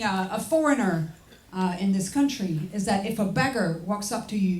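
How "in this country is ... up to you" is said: ordinary talk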